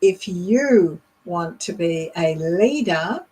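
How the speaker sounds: slow, stressing "you" and "leader"; drawn-out "you" and rising "leader", deliberately paced; emphasized focus words. Shown as a demonstration. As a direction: lean hard on "you", lift on "leader".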